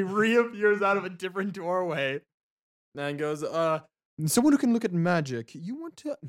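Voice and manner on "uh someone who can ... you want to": lightly voiced Jala, higher and louder at first; a higher-pitched attempt at a character voice; botched Jala voice. Game master misses Jala's voice answering the party, botched character voice. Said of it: "that was not Jala's voice either".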